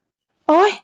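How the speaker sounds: high startled yelp; sudden shocked ouch; startled cry. A narrator's reading. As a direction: jump with fright and cry out. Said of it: "ถึงกับสะดุ้งเฮือก".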